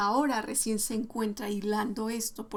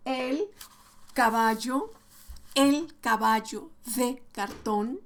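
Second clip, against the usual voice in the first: lectura pausada a un niño; 4 dB louder than usual. Shown as a demonstration. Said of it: "si tú empiezas a leerle".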